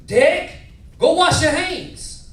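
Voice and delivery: high, loud Mississippi grandfather; Deep South accent. Retold or imitated. Grandfather ordering grandson to wash up, loud Deep South accent. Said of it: "But this how he said it".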